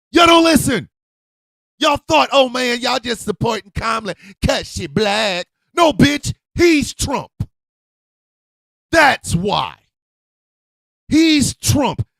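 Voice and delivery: high, loud shouting in a rage; furious shout. Real, not acted. Ranting at voters who ignored warnings, furious shout. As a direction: yell it at them, fed up. Angry rant at Trump voters.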